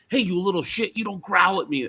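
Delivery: mock-scold a growling dog; retold angry scolding. Ex-wife scolding the growling Chihuahua, imitated angry, high. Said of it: "she was pissed off".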